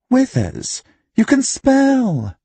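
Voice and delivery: very high, drawn-out teasing marvel; mock amazement. A narrator's reading. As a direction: tease her with exaggerated high-pitched wonder. Trueblood mocks the charwoman.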